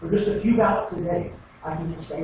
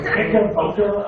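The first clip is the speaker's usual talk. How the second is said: ordinary talk, nothing marked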